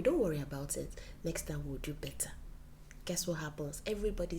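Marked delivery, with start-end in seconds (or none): 0.0-2.3 soft comfort. Retold or imitated. Voicing wife's comfort.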